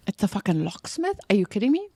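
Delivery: ordinary talk, nothing marked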